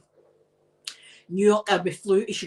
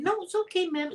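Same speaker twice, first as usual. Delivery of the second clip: calming guard; 9 semitones higher, 3 dB quieter than usual. Retold or imitated. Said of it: "he calmed me down".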